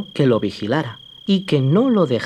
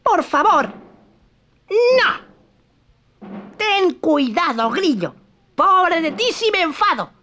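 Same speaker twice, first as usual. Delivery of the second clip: Pinocchio's threat; 10 semitones higher than usual. Acted.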